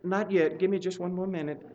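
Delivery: hushed, mild imitation of his wife; soft reply. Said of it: "And she'll say".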